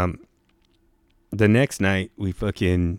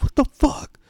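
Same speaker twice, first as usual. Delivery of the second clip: high startled big-guy voice; big camper hit by a rock, high mock yell. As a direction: jump up and shout it high. Max jumps up, retold.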